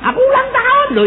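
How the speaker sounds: very high, loud pleading protest; hammed-up indignant reminder; mock birthday reminder. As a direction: squeal it like a neglected sweetheart. Acts out birthday reminder to partner.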